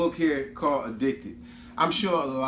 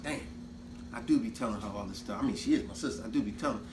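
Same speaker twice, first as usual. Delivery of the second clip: 1 realizing inner voice; 5 semitones lower, 7 dB quieter, faster than usual. Retold or imitated. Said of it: "Bryce realized".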